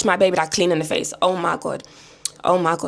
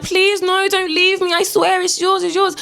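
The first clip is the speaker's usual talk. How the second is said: high, wailing mum voice; mock desperate pleading; 11 semitones higher, slower than usual. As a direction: plead after him in a put-on high whine. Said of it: "She's chasing after him like".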